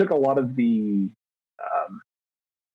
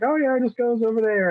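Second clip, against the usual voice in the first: much higher, quick and casual; breezy coworker answer; 10 semitones higher, faster than usual. Retold or imitated. Coworker pointing to the printer, breezy, higher and quick. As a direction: toss it off lightly, quick and higher. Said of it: "they're like".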